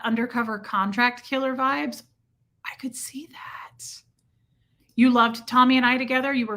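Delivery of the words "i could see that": breathy unvoiced whisper. Streamer answering a spy comment in a mock whisper. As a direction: murmur it like a secret agent.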